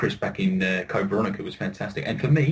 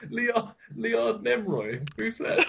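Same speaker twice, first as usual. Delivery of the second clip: laughing; 12 semitones higher, much slower than usual. Real, not acted. Laughing in disbelief at name.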